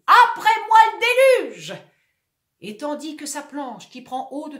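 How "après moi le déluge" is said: high, loud defiant cry; shouting. Acted. Sinking actor shouting defiance. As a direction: fling it out defiantly. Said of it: "il crie".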